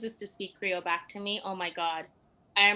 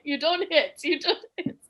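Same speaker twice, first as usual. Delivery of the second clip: laughing scold; 8 semitones higher, 8 dB louder than usual. Retold or imitated.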